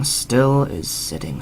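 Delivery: usual speaking voice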